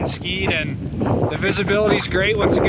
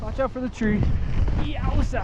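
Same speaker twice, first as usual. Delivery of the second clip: skiing downhill; 8 semitones higher than usual. Real, not acted. Calling out while skiing trees.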